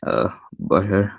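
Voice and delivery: Butthead impression. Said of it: "my best butthead voice".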